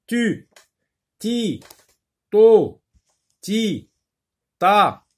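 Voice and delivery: "Tu. Ti. To. Ti. Ta." high, one at a time; teacher chanting out single syllables with gaps between; syllable reading drill. Shown as a demonstration. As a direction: read each of "Tu. Ti. To. Ti. Ta." as a separate falling call. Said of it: "on va lire des syllabes".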